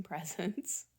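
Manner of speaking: normal talking voice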